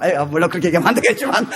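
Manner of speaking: ordinary delivery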